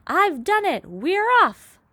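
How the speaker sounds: high, very loud triumphant yell; triumphant shout. A narrator's reading. Centipede pokes his head through the ceiling, triumphant shout. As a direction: crow it out in victory. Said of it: "and shouted".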